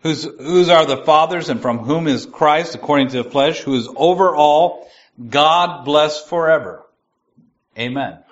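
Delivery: steady, solemn reading voice; scripture reading. Acted. Teacher reading Romans one aloud, measured and solemn. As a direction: let the verse carry weight.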